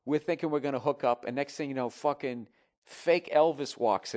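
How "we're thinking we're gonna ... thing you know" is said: ordinary talk, nothing marked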